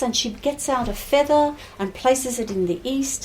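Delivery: ordinary delivery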